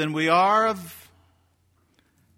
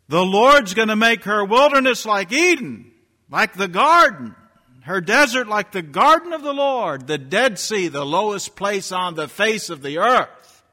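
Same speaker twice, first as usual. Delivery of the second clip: high, loud scripture proclamation; preaching at full force; 9 semitones higher, 9 dB louder than usual. Acted. Preacher to his congregation, proclaiming scripture high and loud. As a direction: thunder the promise out over the pews.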